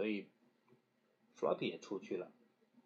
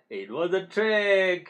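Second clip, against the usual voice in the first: laughing; 5 semitones higher, 6 dB louder, slower than usual. A narrator's reading. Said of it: "laughed Keeper".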